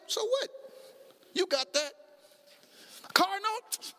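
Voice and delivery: casual dismissive confidence; carefree shrug. Pretend. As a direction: brush off the bills lightly. Voicing carefree faith to God.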